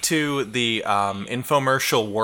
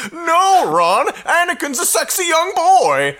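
very high, loud cartoon cry; hammed-up, high cartoon outburst; weird cartoon voice; 14 semitones higher, 6 dB louder than usual. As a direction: squeal it as an insistent cartoon. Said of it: "cartoon voice would be like".

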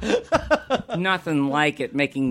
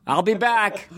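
Terminator voice; 9 semitones higher than usual. Retold or imitated. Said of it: "three dollars ... roast beef dinner".

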